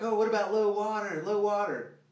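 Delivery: high, loud whiny mock question; high nagging mock voice; mocking other anglers. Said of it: "People are like".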